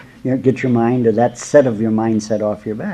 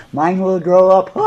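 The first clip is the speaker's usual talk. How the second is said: high, louder laughing line; laughing guru; 8 semitones higher, 6 dB louder than usual. Retold or imitated. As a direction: say it while laughing like the rolling guru. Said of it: "laughing and rolling on the floor".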